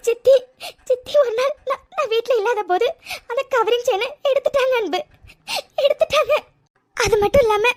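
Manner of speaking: teary story told between sobs and little laughs; crying. Acted. Retelling the aunt's theft to her husband, tearfully. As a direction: weep while thanking. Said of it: "முதல்ல அழுகிறது நிறுத்து".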